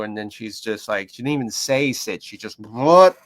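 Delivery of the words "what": loud, rising imitated correction noise; sudden sharp noise. Handler correcting dog without saying sit, abrupt loud noise. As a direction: burst out abruptly, no command. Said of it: "like that".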